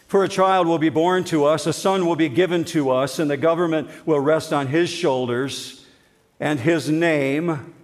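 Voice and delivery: formal scripture reading. Acted. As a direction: declaim the verse to the hall. Reads Isaiah aloud.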